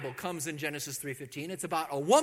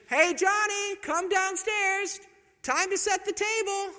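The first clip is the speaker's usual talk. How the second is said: much higher, loud sing-song mom call; mom calling upstairs; 7 dB louder, slower than usual. Retold or imitated. Mom calling her son down for dinner, high and loud. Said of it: "some mom ... says".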